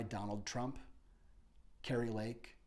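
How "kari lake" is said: ordinary delivery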